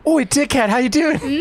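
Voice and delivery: high-pitched brash Australian; squeaky Australian badger voice; Australian accent. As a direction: call out like a cheeky Aussie. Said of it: "she's just Australian".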